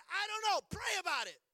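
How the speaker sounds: frustrated snap. Retold or imitated. Frustrated dad snapping at his son to pray. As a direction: snap at your son, fed up. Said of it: "I'm a little frustrated".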